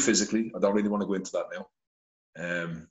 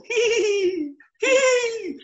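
squeaky falsetto giggle, much higher and louder; giddy high giggle; 4 dB louder, much slower than usual. Retold or imitated. Inmates delighted by flushing toilets, giddy high giggle. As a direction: giggle like delighted lads in the cells. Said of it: "loads of fellas go".

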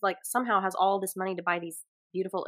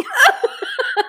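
a sudden high burst of laughter; 7 dB louder than usual. Real, not acted. Laughing at her own French, a high laugh. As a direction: cackle at the joke.

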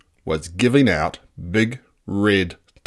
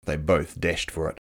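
usual speaking voice

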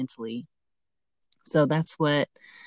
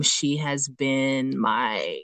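ordinary talk

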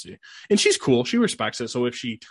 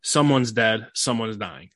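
ordinary delivery

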